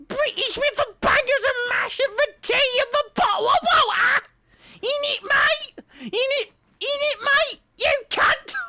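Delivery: shrieking, very high British caricature; hammed-up British rant in a piercing pitch; over-the-top British accent. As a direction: screech it like an outraged British yob. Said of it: "because I'm British".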